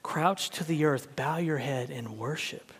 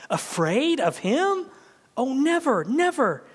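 adoring awe; 9 semitones higher, 4 dB louder than usual. Acted. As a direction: lift it with wonder. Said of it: "his eyes shining with unutterable love".

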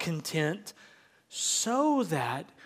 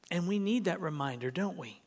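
ordinary talk

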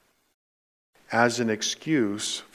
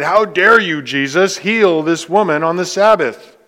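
indignant ruler; 4 semitones higher, 8 dB louder than usual. Retold or imitated. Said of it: "In other words, he said".

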